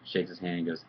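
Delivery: usual speaking voice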